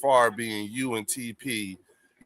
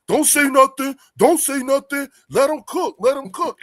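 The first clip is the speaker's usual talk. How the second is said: much higher, louder hyped imitation; excited hype; 13 semitones higher, 6 dB louder than usual. Host imitating late friend telling everyone to let him cook, hyped. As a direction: hype it up like a fan egging them on. Imagining Fells cheering the debate.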